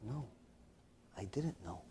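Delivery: barely audible low reply; stunned hush. Retold or imitated. Son learning his mother has died, stunned and hushed. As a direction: let it out very softly, hardly any voice.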